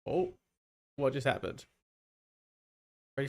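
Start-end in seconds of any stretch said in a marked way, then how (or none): none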